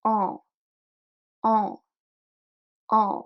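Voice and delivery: nasal "en" with growing force. Shown as a demonstration. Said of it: "So let's try".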